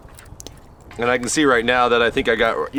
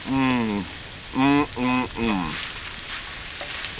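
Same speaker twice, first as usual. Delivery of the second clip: satisfied mmm; 6 dB quieter, much slower than usual. Real, not acted. Savoring the taste.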